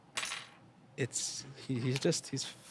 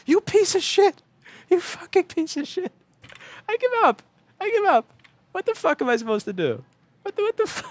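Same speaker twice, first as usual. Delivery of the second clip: furious yelling; 7 dB louder than usual. Real, not acted. Raging at a dead board.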